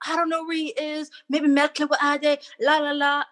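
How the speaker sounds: high, sing-song mock brush-off; mocking the locals who shrugged her off; dismissive locals. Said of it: "I would ask everybody".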